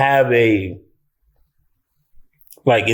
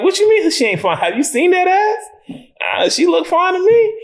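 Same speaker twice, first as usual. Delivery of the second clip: very high-pitched, incredulous protest; mock indignation. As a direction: defend her in squeaky disbelief. Defending actress against critics.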